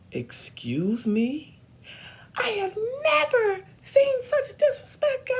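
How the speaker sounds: hammed-up outraged scream, very high; screaming director. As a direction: start drawn-out and indignant, build to a shrieking rant.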